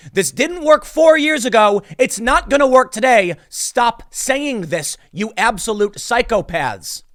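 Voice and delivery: high, loud exasperated rant; fed-up shout at the lawmakers. Acted. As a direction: yell it like you've had enough. Ranting quote at lawmakers.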